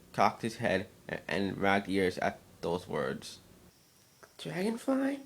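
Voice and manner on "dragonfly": high small creature voice, asking; a young creature trying out a new word; curious little changeling. A narrator's reading. Changeling repeating his name, high and wondering.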